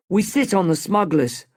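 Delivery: ordinary delivery, nothing marked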